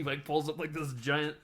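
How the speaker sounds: normal talking voice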